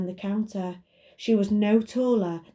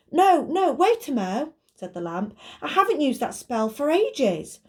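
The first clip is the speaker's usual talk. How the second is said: flustered magic lamp voice; 7 semitones higher, 3 dB louder than usual. Acted.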